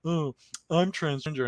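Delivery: lightly put-on casual reveal; quoting him. Retelling the show, the boy explains in a light put-on voice. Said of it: "He's like".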